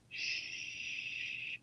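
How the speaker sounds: barely audible long shush; hushing shh. Retold or imitated. Dad in a flashback looks at son, soft shh. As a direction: shush very softly and steadily. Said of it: "he looked at me and he went".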